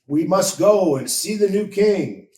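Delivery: crowd cry. A narrator's reading. Shepherds urge each other toward Bethlehem, calling out. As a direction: call out to all the others to go.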